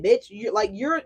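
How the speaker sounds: ordinary delivery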